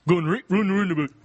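tight-mouthed Geordie with "oo" vowels; Geordie accent. Shown as a demonstration. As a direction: rattle the line off in Geordie. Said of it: "Thank you, Jordy".

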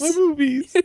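very high, squeaky mock bewilderment; wronged innocence. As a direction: whine like a kid unfairly in trouble. Retold wounded innocence, echoed in jest.

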